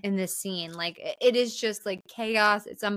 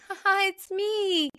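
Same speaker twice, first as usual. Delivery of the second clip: high, teasing laugh as the fake Sarah; mock laugh; 10 semitones higher than usual. Nancy glamoured as Sarah, high mock laugh. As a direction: laugh it off, playing someone else. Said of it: "like, laughs".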